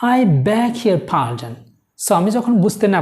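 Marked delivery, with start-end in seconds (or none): 0.0-1.5 slow, careful I beg your pardon; slow model phrase. Shown as a demonstration. Teacher models phrase slowly for Bengali learners, slow and careful. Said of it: "সেটা হচ্ছে".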